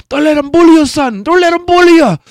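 high, loud imitation of a fight coach; corner man impression. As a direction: holler it like a cornerman firing up his fighter. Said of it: "He was just like".

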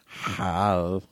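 raspy whispered alien creature; Stitch voice. Pretend. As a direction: hiss it like a little alien. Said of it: "an entire review as Stitch".

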